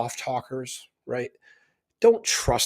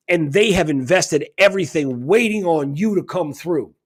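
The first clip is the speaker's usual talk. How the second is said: furious rant; 2 semitones higher, 4 dB louder than usual. Retold or imitated. Speaker retells blasting Jared Rand, loud and outraged. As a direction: tear into him, outraged.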